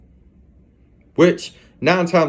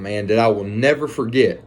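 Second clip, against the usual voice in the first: usual speaking voice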